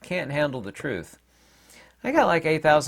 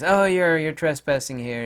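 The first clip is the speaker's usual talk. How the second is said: higher, louder mimicked objection; mock scolding; 5 semitones higher, 5 dB louder than usual. Manager confronting a departing customer, mock indignant. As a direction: mimic an officious telling-off, starting high.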